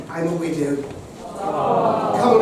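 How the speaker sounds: ordinary talk, nothing marked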